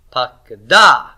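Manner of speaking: "pakda" with loud final DA; stress on the ending. Shown as a demonstration. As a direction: demonstrate "pakda" as pak-DA, stress pulled to the back. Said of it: "unless I have the vocalic ending".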